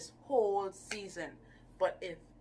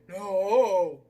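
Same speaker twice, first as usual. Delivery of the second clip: mock sharp no; 14 dB louder than usual. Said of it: "she was just like".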